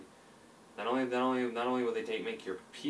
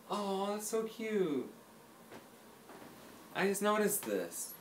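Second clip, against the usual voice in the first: delighted; 8 semitones higher, slower than usual. Real, not acted. Noticing the cute cookie.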